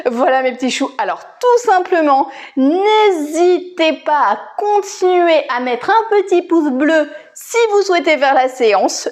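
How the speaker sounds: ordinary talk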